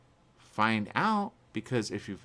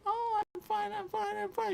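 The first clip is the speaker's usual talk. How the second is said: high, mocking put-on voice of careless people; high mock "I'm fine". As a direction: mimic someone brushing it off, pitched way up. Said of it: "People think, 'Oh".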